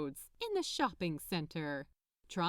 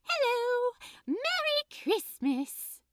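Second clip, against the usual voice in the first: much higher and louder, slow bubbly greeting; cheerful elf woman; 6 dB louder, slower than usual. Acted. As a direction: sing out a big elf hello. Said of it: "a cheerful woman dressed as an elf".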